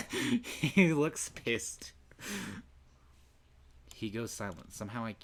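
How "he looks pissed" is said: big loud burst of laughter; laughing out loud at the angry leopard. Real, not acted. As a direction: laugh hard then gasp the words slowly. Streamer cracks up.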